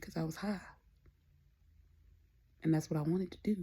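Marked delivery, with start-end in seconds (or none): none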